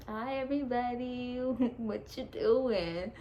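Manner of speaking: slow, singsong loopy greeting; spaced-out, stretched hello with a laugh; doped up. Retold or imitated. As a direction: imitate being high and giggly, dragging out the words. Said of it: "I was doped up".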